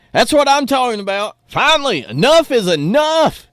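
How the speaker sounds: high, exultant rallying cry; fired-up cheering. Acted. Host cheering French farmers who throw manure, fired-up and high.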